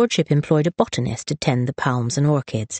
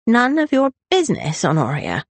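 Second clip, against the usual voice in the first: high start, falling onto the name; irritable, higher-pitched brush-off; testy rebuff; 9 semitones higher than usual. A narrator's reading. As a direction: drunk brother snapping back. Brother rebuffs his sister.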